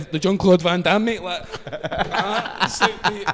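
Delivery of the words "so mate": ordinary talk, nothing marked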